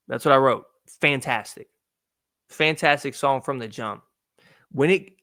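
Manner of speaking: ordinary talk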